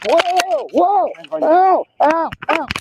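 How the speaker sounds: sharp ows while being cuffed; pained yelps. Real, not acted. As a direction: yelp in real pain. Being arrested, in pain.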